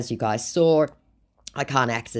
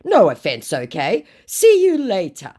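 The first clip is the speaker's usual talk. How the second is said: loud put-on sign-off, rising high; scammer's put-on voice; 7 semitones higher, 7 dB louder than usual. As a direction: say goodbye as the scammer. Acts scammer's farewell.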